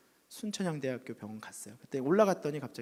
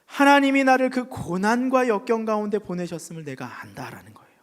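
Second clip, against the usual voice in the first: preaching at full force; 10 dB louder than usual. Acted. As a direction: proclaim it to the hall. Preaching climax. (힘차게 설교하는 목소리)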